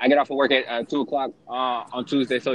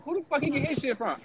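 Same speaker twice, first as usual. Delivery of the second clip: incredulous; 12 semitones higher, 4 dB quieter, faster than usual. Retold or imitated. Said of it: "I said".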